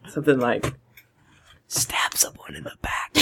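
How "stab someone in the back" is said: low, conspiratorial whisper; whispered life lesson. Acted. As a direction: lean in and whisper it as secret advice. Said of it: "I lean in and I whisper".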